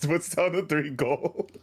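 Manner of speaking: talking through laughter, high-pitched; squeezed out high between laughs; laughing. Real, not acted. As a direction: tell it while cracking up. Laughing at failed bet.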